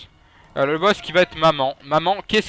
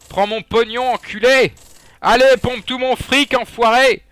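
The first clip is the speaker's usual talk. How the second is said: louder, higher, hammed-up rage at a greedy machine; mock angry yelling; 7 semitones higher, 6 dB louder than usual. A gamer mock-yells at the donation machine, hammed-up rage. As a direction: bellow the insults in fake fury.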